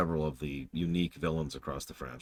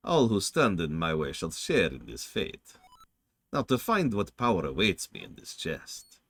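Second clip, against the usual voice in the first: theatrical vampire with lilting, swooping pitch; vampire villain, haughty. Acted. Villain finding the chest empty, haughty vampire. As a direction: muse grandly like a vampire.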